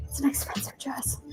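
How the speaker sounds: soft unvoiced whisper. Girl in the van murmurs about her dress, whisper. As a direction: breathe it as a secret.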